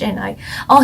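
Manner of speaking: normal talking voice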